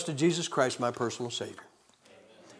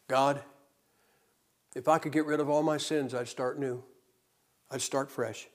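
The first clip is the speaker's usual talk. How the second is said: retold tearful prayer; 4 semitones lower, 8 dB quieter than usual. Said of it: "I'd cry every time I prayed that prayer".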